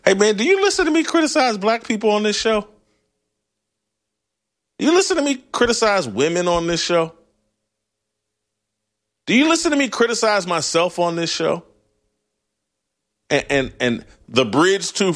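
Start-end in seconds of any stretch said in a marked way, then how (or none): 0.0-11.6 higher-pitched, fast indignant comeback; heated retort. Retold or imitated. Replying to the angry emailer, higher and fast. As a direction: fire it back at the emailer, pitched up. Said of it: "It's like".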